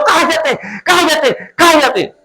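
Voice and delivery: high mock shout of cállate; squeaky playful yell, repeated; mock divine scolding, high-pitched; God shouting shut up. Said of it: "Dios te está gritando".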